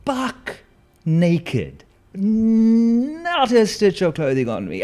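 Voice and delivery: high, loud comic outburst; loud drawn-out exclamation; shouting it. Retold or imitated. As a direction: belt it out in disbelief. Comic peak, naked fighting.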